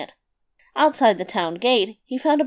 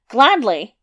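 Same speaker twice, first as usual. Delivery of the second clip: eager; 3 semitones higher, 8 dB louder than usual. A narrator's reading. Tailor eager.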